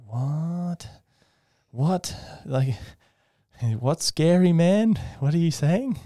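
high, drawn-out baffled questioning; drugged confusion. Retold or imitated. Drugged patient questions the scary man, high-pitched mock bewilderment. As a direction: act out a dazed patient's rising disbelief. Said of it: "I had a lot of fentanyl".

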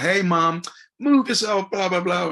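high, loud shout at mum; calling out. Retold or imitated. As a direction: call out sharply, brushing her off. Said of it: "I'll be like".

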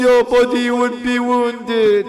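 very loud, high, slow wailing oratory; preacher lamenting at full force; impassioned lament sermon. Real, not acted. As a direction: stretch each phrase into a grieving cry.

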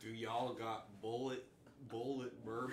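mock country twang; Southern accent. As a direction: order like a fake Southerner. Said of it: "say it with a southern accent".

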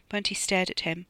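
usual speaking voice

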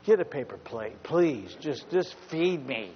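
ordinary talk, nothing marked

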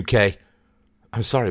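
ordinary delivery

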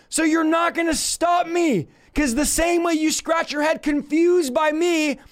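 high-pitched, full-voiced sermon shouting; defiant preaching shout. Real, not acted. Preacher answering critics who call him crazy, defiant shout.

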